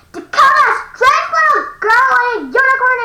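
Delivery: loud call up the stairs. Acted. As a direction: call her name across the house. Parent calling upstairs.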